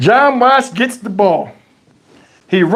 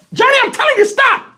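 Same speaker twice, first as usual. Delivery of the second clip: put-on very high scolding old lady; grandma voice; faster than usual. Grandma warning.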